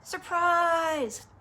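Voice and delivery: high, loud surprise cheer; group shout. A narrator's reading. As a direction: shout it out like a roomful of party guests. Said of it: "Then all the ladies shouted".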